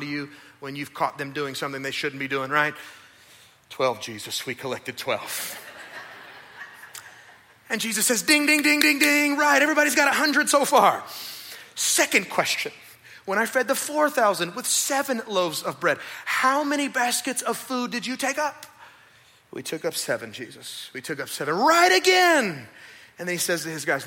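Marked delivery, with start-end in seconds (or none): 3.7-5.6 low, meek mumbled answer; sheepish disciples. Acted. Disciples answering Jesus, a low sheepish mumble. 8.2-11.0 loud, high game show cheer; mock quiz show host. Jesus grading his disciples like a cheery quiz host. 19.5-21.5 low, sheepish reply; meek disciples. Acted. Disciples answering Jesus, low and sheepish. 21.5-22.7 high, loud, drawn-out cheer; mock praise. Jesus praising the disciples, loud and high.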